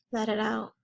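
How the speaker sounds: sharp breath in, then breathe the words out softly; soothing a crying child by breathing along; breathing out demo. Shown as a demonstration. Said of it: "Take another breath in".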